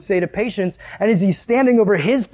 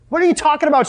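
high-pitched, scoffing disbelief; incredulous mourners; 8 semitones higher, faster than usual. Acted. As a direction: throw it back as an outraged challenge. Mourners mock Jesus' claim.

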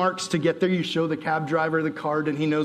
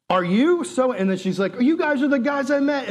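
surprised recognition; 7 semitones higher than usual. Retold or imitated. Surprised meeting.

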